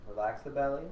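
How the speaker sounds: normal talking voice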